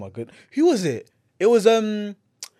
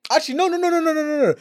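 high protesting no; 11 semitones higher, faster than usual. Real, not acted. Rejecting own ranking.

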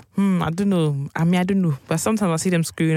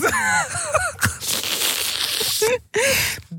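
long, very high-pitched laughing fit; helpless laughter. Real, not acted. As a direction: crack up in high squealing laughter. Cracking up at worst nightmare remark.

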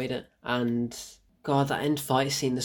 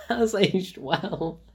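laughing; 5 semitones higher, slower than usual. Real, not acted. Amused reversal of mock verdict.

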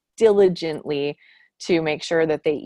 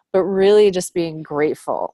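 ordinary talk, nothing marked

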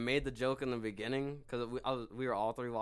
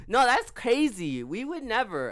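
much higher and louder, dragged-out fake shock; reality show girl voice; 10 semitones higher, 10 dB louder, slower than usual. Imitating the girls on the show denying they kissed, in a high loud mock-shocked voice. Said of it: "I'm talking like that because they talk like that".

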